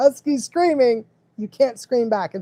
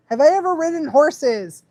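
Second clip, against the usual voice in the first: ordinary delivery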